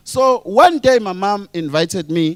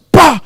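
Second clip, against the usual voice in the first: loud, high vocal bang; sudden shouted gun noise; imitated gunshot; 7 semitones higher, 9 dB louder than usual. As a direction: fire off one explosive shot sound.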